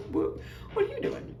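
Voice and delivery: unvoiced, high, prying mom; mother prying into what her daughter is up to, whispered; whispered suspicious mom. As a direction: whisper the question like a nosy mother. Mom pressing.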